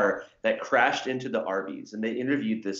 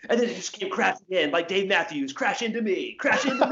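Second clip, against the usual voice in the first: high, rising loopy rant voice; imitating the rambling interviewee; 9 semitones higher than usual. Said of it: "he's like".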